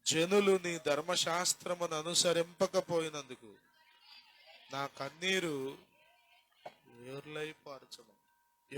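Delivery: slow, solemn reading of the verse; scripture reading. Acted. Preacher reading the psalm verse to the congregation, solemn. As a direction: read the verse with weight.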